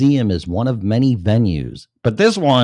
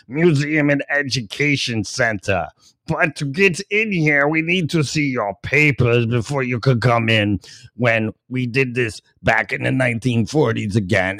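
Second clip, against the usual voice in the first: higher mock-official voice with a sneer; sarcastic museum voice; 3 semitones higher than usual. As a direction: play the smug institution, sneering. Mocking the museum's voice.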